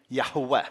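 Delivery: "Yahweh" as YAH-hweh, short ah, the H sounded, stress on the first syllable; careful reconstructed "Yahweh", first syllable stressed, H pronounced; correct Yahweh. Shown as a demonstration. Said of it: "if you want to do it correctly".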